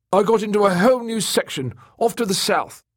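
high-pitched, eager character voice; excited. Acted. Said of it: "he said excitedly".

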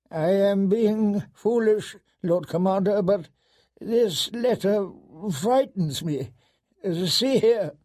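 put-on slow, high, frightened old man; hesitant, quavering elderly voice; trembling old steward. A narrator's reading. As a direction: falter fearfully through each phrase. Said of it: "The old steward was trembling".

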